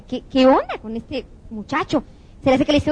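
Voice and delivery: ordinary talk, nothing marked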